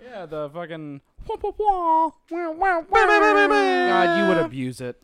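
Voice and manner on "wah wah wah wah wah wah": higher repeated wah noises like a soundboard clip; mock wah sound effect. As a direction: bleat out the silly sound effect. Imitating the viral soundboard noise.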